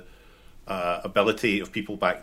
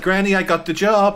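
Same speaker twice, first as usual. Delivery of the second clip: thrilled and much louder; excited; 11 semitones higher, 8 dB louder than usual. Retold or imitated. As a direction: announce the news to Granny, overjoyed. Quoting the Total Jobs advert.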